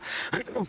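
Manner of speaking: short breathy dry cough. Real, not acted. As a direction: cough mid-sentence. Sick speaker coughs mid-sentence. (toux sèche)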